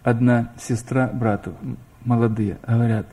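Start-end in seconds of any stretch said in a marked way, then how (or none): none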